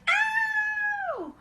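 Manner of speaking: very high, tiny yelp of "ow"; Baby Bear stung, squealing; squeaky cry of pain. A narrator's reading. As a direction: squeal it like a little cub. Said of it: "Baby Bear cried".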